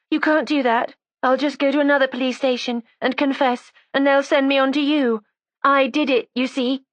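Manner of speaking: put-on high, defiant young woman; resentful young woman. A narrator's reading. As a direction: voice her as a sulky, stubborn girl. Narrator voices young woman Judy.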